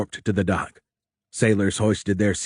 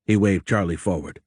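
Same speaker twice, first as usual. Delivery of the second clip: ordinary delivery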